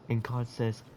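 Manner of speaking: normal talking voice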